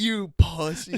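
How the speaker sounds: slow, high mocking taunt; slow mocking jeer. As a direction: drag out the insult. Firemen mocking him.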